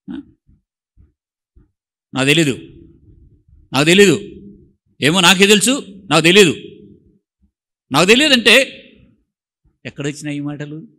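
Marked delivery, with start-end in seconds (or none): 2.1-6.5 loud snapped నాకు తెలీదు, imitating a sulker; mock angry retort. 7.9-8.8 mock angry retort.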